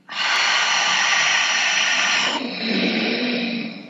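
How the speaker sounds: constricted throat breath, mouth closed; ocean-like breath through the nose; Ujjayi breath. Shown as a demonstration. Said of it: "do you hear that difference? It's like you're constricting your throat".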